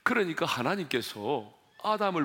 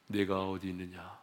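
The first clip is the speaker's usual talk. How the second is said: low, soft, conversational voice; gentle, loving question; 8 semitones lower, 6 dB quieter than usual. Acted. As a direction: ask it quietly, as in tender counsel. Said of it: "큰 소리로 외치지 않았습니다". (낮고 다정한 목소리로)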